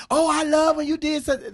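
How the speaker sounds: high, loud mock fan gush. Fan meeting a celebrity, high loud gush. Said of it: "when you meet them".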